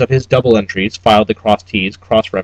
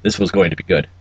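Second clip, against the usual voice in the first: plain reading voice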